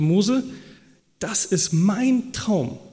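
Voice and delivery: mein slowly weighted, stretched out; God pleading with Moses in earnest; insistent God. Acted. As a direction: lean hard and slow on mein.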